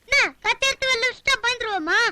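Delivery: high-pitched defiant bluster; defiant threat. Acted. Rival shows a knife and is defied, high bluster.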